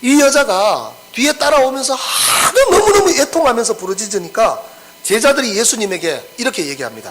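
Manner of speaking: ordinary talk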